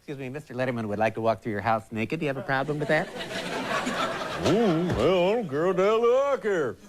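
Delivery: a hint of a clueless dope; dumb guy voice. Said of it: "my dumb guy voice".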